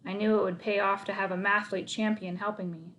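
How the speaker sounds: plain reading voice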